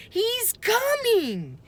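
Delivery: high, slow alarmed cry. A narrator's reading. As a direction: warn him the dinosaur is coming. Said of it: "Annie shouted".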